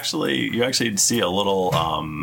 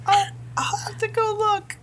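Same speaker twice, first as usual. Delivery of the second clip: squeaky, very high mouse voice; tiny mouse voice. Acted. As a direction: squeak like a timid little mouse.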